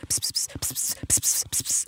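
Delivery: soft unvoiced pss sounds; whispered hiss. The couple arguing in the car, hissed whispers. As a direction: mimic a couple hissing at each other.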